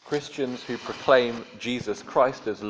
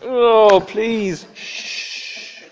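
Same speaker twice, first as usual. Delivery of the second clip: exasperated plea; 12 semitones higher, 8 dB louder, much slower than usual. Retold or imitated. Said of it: "And I'm thinking, like".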